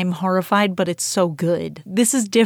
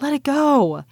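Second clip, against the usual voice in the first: higher-pitched mock yell, only a little louder; retold mild scream; 7 semitones higher, 4 dB louder than usual. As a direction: imitate an outburst without really screaming. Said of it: "he kinda starts screaming".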